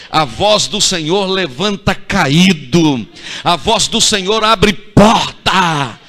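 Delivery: building to loud, high fervent preaching; preaching at full force. Acted. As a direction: hammer each line harder to the church.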